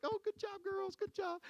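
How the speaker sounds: very high, sugary praise; sweet coach voice. Retold or imitated. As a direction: coo it like a gentle coach to little girls. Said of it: "I'm just like".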